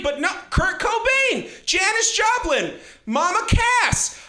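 high, drawn-out roll call of names; mock lament. People mourning the twenty-seven list, hammed-up lament. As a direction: wail each name slowly in mock grief. Acted public lament for dead stars.

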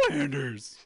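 a hint of Homer Simpson; lightly voiced cartoon dad; Homer impression. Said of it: "the way Homer says his name".

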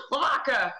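sudden shocked cry of the hamster's name; gasped name. Acted. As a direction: gasp then shout the name, shocked.

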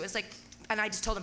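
ordinary talk, nothing marked

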